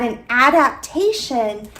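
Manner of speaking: word by word with long pauses; slow dictation, a phrase at a time as you write; dictating while writing. Real, not acted.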